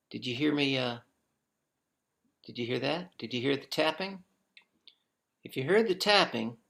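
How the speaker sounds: ordinary talk, nothing marked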